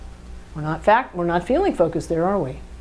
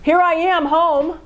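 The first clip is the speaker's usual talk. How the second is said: higher, insistent husband; husband answering his wife, pitched up and insisting; defensive fact person; 8 semitones higher than usual. Acted. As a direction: insist you're here, pitched up. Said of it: "a fact-based person going".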